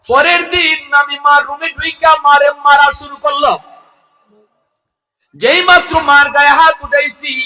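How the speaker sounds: high, loud sermon declamation; impassioned high-pitched preacher's chant; full-force preaching. Acted. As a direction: ring it out to a big congregation, stretching the last word.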